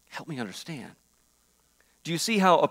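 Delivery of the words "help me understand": quiet plea. Retold or imitated. Abram's plea ends quietly.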